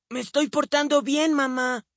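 put-on high, sweet innocent boy; innocent little boy. A narrator's reading. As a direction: fake innocence to fool mum. Billy answers innocently.

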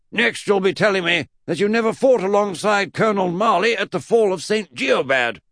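put-on loud, high, indignant old eccentric; indignant accusation pitched right up; Mad Uncle Jack. Great-uncle accuses Eddie, loud high indignation.

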